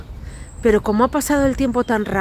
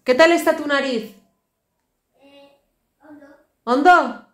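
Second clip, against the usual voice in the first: raised, higher voice calling to another room; 5 semitones higher, 4 dB louder than usual. Real, not acted. Mother calling out to her sick son in his room. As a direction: call out to her son down the hall.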